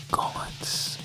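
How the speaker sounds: ordinary talk, nothing marked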